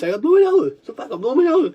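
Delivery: high, strained insistence; desperate urgency. Real, not acted. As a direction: let the voice jump up in desperation. Desperate urge to blow nose.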